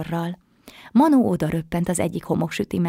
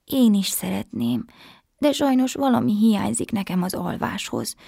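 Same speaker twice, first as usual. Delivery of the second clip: ordinary delivery, nothing marked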